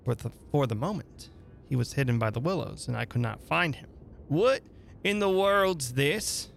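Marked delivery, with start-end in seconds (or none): 4.3-6.5 higher, slow startled cry. Acted. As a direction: cry out puzzled and alarmed. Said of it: "I heard him cry again".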